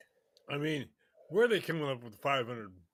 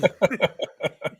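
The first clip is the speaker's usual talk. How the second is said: sudden loud laugh; loud burst of laughter; 3 semitones higher, 10 dB louder than usual. Real, not acted. Laughs after joke line.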